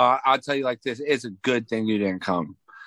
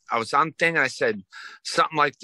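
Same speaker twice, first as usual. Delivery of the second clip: ordinary delivery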